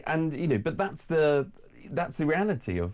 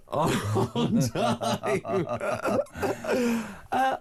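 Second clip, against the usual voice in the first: giggling high through the words; laughing; 10 semitones higher, much slower than usual. Real, not acted. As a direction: laugh it out, caught off guard. Laughing at the banter.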